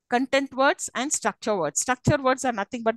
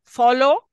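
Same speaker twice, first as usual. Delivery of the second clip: "Follow" much louder, rising; stressed word; 8 dB louder than usual. Shown as a demonstration. Teacher isolates the key word for students, loud stressed "Follow". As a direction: punch out "Follow" with a lift at the end. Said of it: "follow is the word which I'm going to stress".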